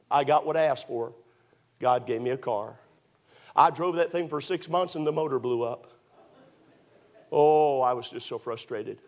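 normal talking voice

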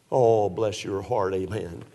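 low, slow, syrupy mock pity; mock sympathy. Preacher mocking tired churchgoers, sarcastic pity. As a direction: coo it with fake sympathy.

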